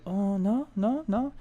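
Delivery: high, soft puzzled voice; clueless woman. Retold or imitated. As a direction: imitate a woman drawing a blank.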